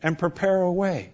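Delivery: quiet assured guidance; soft promise. Acted. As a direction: lead them gently onward. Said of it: "he says".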